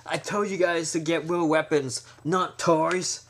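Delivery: a mock exasperated gangster scolding his men; annoyed scolding. Gangster scolding men with crowbar and bat, annoyed. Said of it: "He's like".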